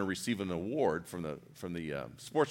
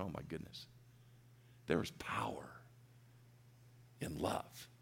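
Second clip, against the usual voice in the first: very soft, low, near whisper; hushed awe; 6 semitones lower, 14 dB quieter than usual. Real, not acted. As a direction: drop right down, reverent and hushed. Awed climax of his moving story.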